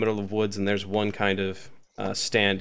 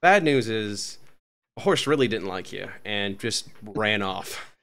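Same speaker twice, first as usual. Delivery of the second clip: ordinary talk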